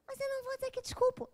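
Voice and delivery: high-pitched objecting voice; protesting friend. Retold or imitated.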